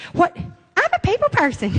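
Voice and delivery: high, chirpy brag of a sales applicant; eager job applicant. Retold or imitated. Applicant in a sales interview, chirpy and high. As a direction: sell yourself a bit too brightly. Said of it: "what's the first thing they always say?".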